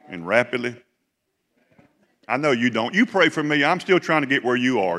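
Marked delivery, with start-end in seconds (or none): 2.3-5.0 rising to a loud, high, half-joking plea; loud mock plea. Real, not acted. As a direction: throw it at the crowd with a grin. Teasing the congregation, preacher building.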